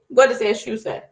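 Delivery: ordinary delivery, nothing marked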